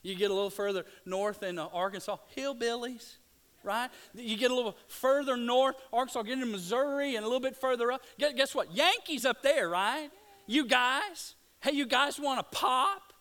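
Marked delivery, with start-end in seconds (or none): 0.3-10.1 loud, high, animated preacher; full-force preaching. Real, not acted. Preacher taking his congregation north to the Yankees, full-force preaching. 10.5-13.1 loud, high-pitched imitation of a Michigan accent; mock Yankee accent. Preacher mimicking Yankees offering a soda, mock Northern accent. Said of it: "and that's how they talk".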